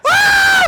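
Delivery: high, loud imitated scream. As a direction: scream like horrified kids. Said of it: "Like, we're screaming".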